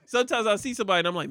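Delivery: ordinary talk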